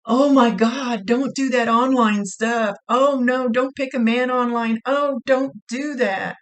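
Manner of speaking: loud, high, slow alarmed pleading; imitating a frightened older woman's loud plea; frightened warning. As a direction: beg them not to do it, half-panicked. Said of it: "she's, like, frightened for you".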